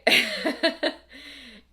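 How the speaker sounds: bursts out in a high, loud laugh. Real, not acted. Laughing at her own pregnancy discomfort, loud and high. Laughs after sarcastic remark.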